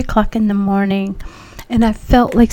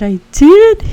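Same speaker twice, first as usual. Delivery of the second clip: high, loud disbelieving question; astonished; 10 semitones higher, 9 dB louder than usual. Retold or imitated. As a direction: gasp it out in amazement. Astonished to hear husband's news.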